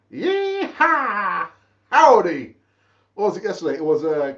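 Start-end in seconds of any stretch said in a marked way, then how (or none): 0.1-1.5 high, whooping cowboy call; a playful cowboy whoop at a viewer's howdy; cowboy yeehaw. Pretend. 1.9-2.6 mock cowboy howdy. Echoing a viewer's greeting.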